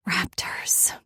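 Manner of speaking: breathless whispered word. Acted. Woman breathlessly giving in to her lover. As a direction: breathe it out, barely voiced. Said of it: "slightly breathless".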